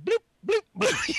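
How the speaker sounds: tiny high bloops; timid drill noise. Retold or imitated. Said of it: "Now I'm going".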